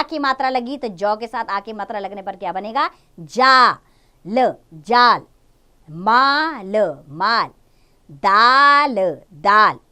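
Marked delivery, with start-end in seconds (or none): 3.2-5.2 जाल with a long drawn आ, louder; drawn-out demo word. Shown as a demonstration. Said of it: "आ का sound आ रहा है ना". 5.9-9.8 माल and दाल with a long clear आ, louder; clear demo words. Shown as a demonstration. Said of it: "आ का sound आ रहा है ना".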